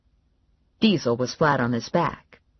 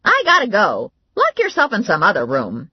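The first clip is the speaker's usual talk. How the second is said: slightly higher and louder, hurried; sister's voice; 6 semitones higher, 6 dB louder than usual. Acted. Valerie hurrying to leave, a touch higher. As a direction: rush her off.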